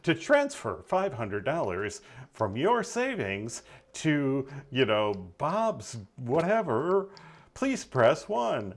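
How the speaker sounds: slow, even automated-menu voice; GPS voice. Retold or imitated. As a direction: read it like a phone menu. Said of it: "do a G P S voice like that".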